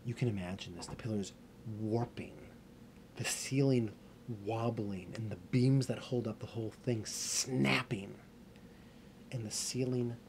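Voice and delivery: ordinary delivery, nothing marked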